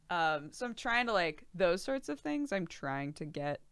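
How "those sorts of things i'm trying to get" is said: ordinary talk, nothing marked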